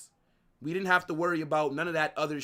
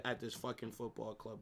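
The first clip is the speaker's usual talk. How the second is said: ordinary talk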